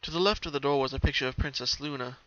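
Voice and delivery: ordinary delivery, nothing marked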